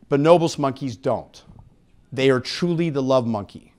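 ordinary talk, nothing marked

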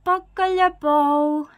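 put-on high, very slow baby babble. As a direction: draw it out loudly like a baby learning to talk. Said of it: "says the baby".

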